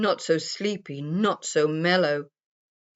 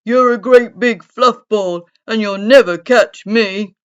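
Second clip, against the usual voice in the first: loud, higher taunting squirrel; teasing; 3 semitones higher, 13 dB louder than usual. A narrator's reading. Cyril Squirrel taunts the sleepy bear, loudly teasing. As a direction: call out a loud playground taunt.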